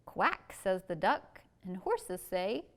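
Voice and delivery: slow, sing-song picture-book reading; reading to children. Acted. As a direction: read it out slowly for little kids.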